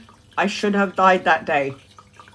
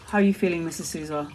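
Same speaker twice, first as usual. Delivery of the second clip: soft, lower, calm bedside voice; calm nurse; 5 semitones lower, 7 dB quieter, much faster than usual. Retold or imitated. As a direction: ask gently like a calm nurse. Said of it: "very calm".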